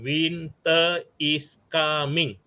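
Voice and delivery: "Winter is coming" drawn out very slowly, word by word; slow dictation. Shown as a demonstration. Teacher shows the poet writing the line, slow dictation. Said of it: "So he write down".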